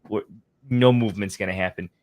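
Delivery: usual speaking voice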